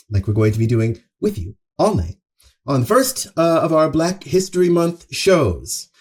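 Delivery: ordinary delivery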